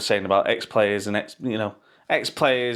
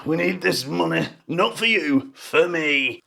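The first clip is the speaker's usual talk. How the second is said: raised, put-on voice mocking a greedy player; hammed-up footballer whine; 5 semitones higher, slower than usual. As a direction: sneer it out as the money-grabbing player.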